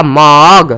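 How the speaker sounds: मग drawn out as "maawg"; O in the middle. Shown as a demonstration. Teacher pointing to the middle vowel, मग said as long "maawg". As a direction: stretch the aw inside मग. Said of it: "मग के बीच में देखो ओ आएगा".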